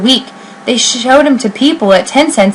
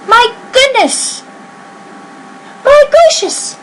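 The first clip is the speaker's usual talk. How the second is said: very high, astonished cries; shouted in amazement; 14 semitones higher than usual. Acted. Crowd seeing the elephant bird, very high and amazed. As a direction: squeal it out in wonder. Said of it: "they shouted".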